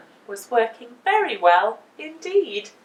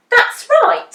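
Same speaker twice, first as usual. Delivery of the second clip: high, loud agreeing cry; Missus Washer; 13 semitones higher, 11 dB louder than usual. A narrator's reading. The laundry woman agreeing at the meeting, high and loud. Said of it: "said Missus Washer".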